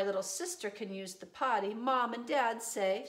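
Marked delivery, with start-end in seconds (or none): none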